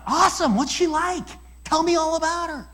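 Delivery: eager interest. Retold or imitated. Preacher encouraging the smitten young man, excited.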